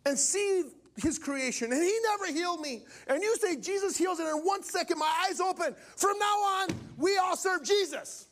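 high testimony building to a shout; impassioned proclamation. Retold or imitated. Healed old cleric addressing the crowd on the mic, impassioned. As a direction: imitate a fervent public declaration, climbing to the last line.

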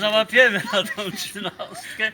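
laughing loudly through the words. Real, not acted. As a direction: say it while laughing at the joke. Joking about pension bonus.

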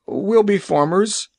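said sadly. Acted. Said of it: "he said sadly".

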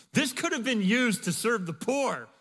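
loud, high indignant outburst. Retold or imitated. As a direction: scoff at the wasted perfume. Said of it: "some who said to themselves indignantly".

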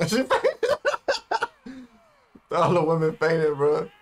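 high, loud laughing through the words. Real, not acted. Watching all the women faint, laughing hard. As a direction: crack up as you say it.

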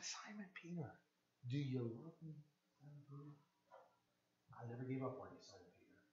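Jesus quietly asking Peter if he loves him; tender plea. Pretend. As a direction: ask him gently, heartbroken.

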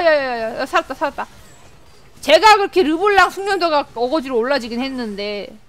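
ordinary delivery

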